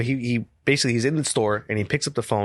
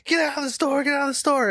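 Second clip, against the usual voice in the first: police shouting. Retold or imitated. Police calling him out.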